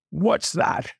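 mildly huffy royal question; snorted. A narrator's reading. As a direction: snort it like an affronted king. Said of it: "snorted Yertle".

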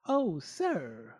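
piglet's imploring call; pleading pig. A narrator's reading. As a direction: beg gently as a little pig. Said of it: "pleaded the pig".

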